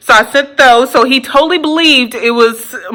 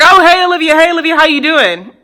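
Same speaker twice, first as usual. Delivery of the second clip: higher, bright call of her name; eager greeting; 6 semitones higher, faster than usual. Retold or imitated. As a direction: greet her like you're thrilled to see her. Ex repeats his greeting.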